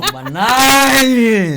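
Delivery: long, high shout of NINE; drawn-out, high-pitched yell. Real, not acted. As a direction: holler it out, stretching the last word. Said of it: "why you shouting".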